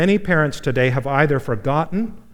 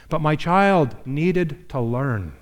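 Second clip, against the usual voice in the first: slow emphasis; much slower than usual. Real, not acted. First statement, drawn out.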